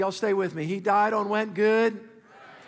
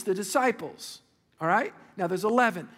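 ordinary delivery

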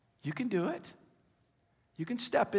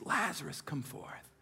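hushed command; 4 semitones lower, 8 dB quieter than usual. Acted. Quoting Jesus, hushed low.

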